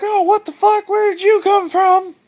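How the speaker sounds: very high, startled squeal; high, shocked outburst; startled god. Retold or imitated. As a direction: caught totally off guard. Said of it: "And Uranus is like".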